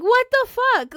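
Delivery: loud, high, startled what the fuck; shocked outburst. Retold or imitated. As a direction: blurt it out at the creep filming. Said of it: "And I'm like".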